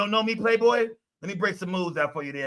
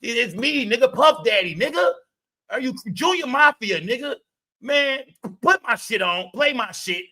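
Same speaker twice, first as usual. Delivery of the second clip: imitating [name]; 5 semitones higher than usual.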